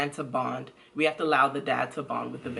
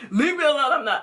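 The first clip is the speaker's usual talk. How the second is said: higher, louder imitation of an annoyed partner; hammed-up brush-off, imitating a touchy dad; mock defensive retort; 10 semitones higher, 5 dB louder than usual. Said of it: "you didn't respond like".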